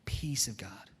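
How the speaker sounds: soft whispered "Peace of God"; gentle hushed reminder. Retold or imitated. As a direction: whisper it to calm an angry friend. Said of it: "He's like".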